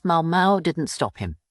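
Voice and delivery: usual speaking voice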